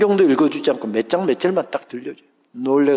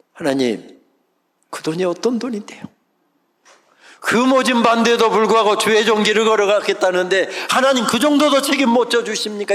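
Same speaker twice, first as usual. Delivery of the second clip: louder, higher protest to God; aggrieved prayer; 5 semitones higher, 3 dB louder than usual. Retold or imitated. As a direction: complain to God with hurt pride.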